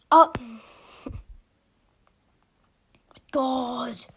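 usual speaking voice

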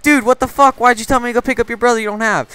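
high-pitched, rushed indignant demand; acted exasperation. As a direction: confront a friend in mock outrage. Retold confrontation with his friend.

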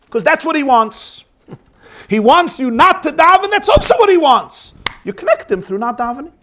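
fervent, high-pitched, climbing insistence; impassioned persuasion. Acted. Zusha persuades his brother that not davening connects him, fervently. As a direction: build to an exultant, emphatic peak.

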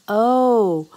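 loud, drawn-out storybook groan. A narrator's reading. Everyone finds the lunch basket gone, groaning. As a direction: let out a long groan of dismay. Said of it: "groaned everyone".

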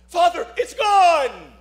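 put-on high, slow, light boy's voice; relieved young man. Freed young man telling the priest, high slow boy's voice.

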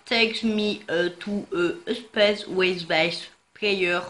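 put-on heavy French accent on English words; slow, stumbling English with French accent. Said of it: "Oui, j'ai un excellent accent". (fort accent français en anglais)

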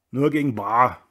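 loud, grumbling landlord; growled refusal. A narrator's reading. Landlord refuses the drinker credit, grumbling. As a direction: grumble it firmly and loudly. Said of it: "brummte der Wirt".

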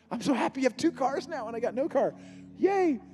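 high, mock-weepy congratulations; almost crying. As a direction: feign joy through a whimper. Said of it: "I'm almost crying".

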